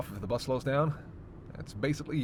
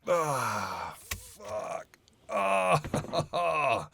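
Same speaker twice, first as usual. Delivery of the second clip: groaning stretch with a whispered curse; satisfied groan, rising at the end; relieved groan; 4 semitones higher, 4 dB louder, much slower than usual. Acted. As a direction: let out a long stretching groan. Stretching legs after long drive.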